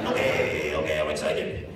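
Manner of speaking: low, flat forced okay, I'm excited; fake excitement. Shown as a demonstration. Audience member told to feel excited, forced. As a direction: pretend to be excited on command. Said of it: "think excited".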